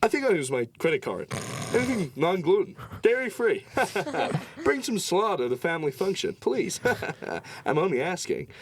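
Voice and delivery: high, half-laughing put-on tourist voice; lightly mock a fussy suburban parent; mock American tourist. Tour guide asking for dairy-free food, laughing in a high mock voice. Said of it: "They probably said it".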